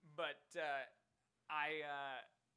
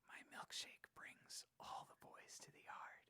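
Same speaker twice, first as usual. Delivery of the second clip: slow, breathy whisper of a pop lyric; mock ASMR whisper; 7 dB quieter than usual. Streamer reads a song lyric in a slow ASMR whisper. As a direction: whisper it slowly like a soothing ASMR read.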